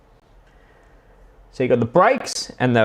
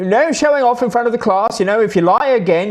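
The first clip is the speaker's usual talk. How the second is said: mock teacher; 9 semitones higher, 3 dB louder than usual. Teacher scolding boy in story.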